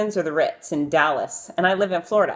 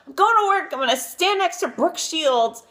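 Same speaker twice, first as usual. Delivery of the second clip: frazzled self-talk; 8 semitones higher than usual. Retold or imitated. Getting ready to meet Brooke Shields, exasperated and frazzled. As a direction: throw up your hands at the mirror. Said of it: "and I thought".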